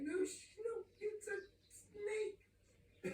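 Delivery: very high, drawn-out mock disbelief; hammed-up shock. Preacher revealing the snake to his audience, hammed-up high shock. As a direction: stretch it out high in mock horror.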